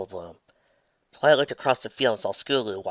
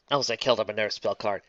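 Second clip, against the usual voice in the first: plain reading voice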